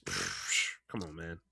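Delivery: normal talking voice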